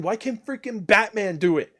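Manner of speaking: ordinary talk, nothing marked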